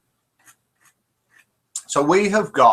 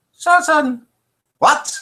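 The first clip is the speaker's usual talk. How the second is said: mock call; 12 semitones higher, 6 dB louder, slower than usual. Mock calling a name.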